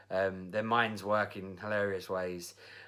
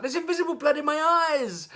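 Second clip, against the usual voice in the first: high-pitched mock hysterical yell; child going nuts; 9 dB louder than usual. Child keeps up the drama at hairdresser, mock shriek. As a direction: shriek like an over-dramatic kid. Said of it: "him going nuts".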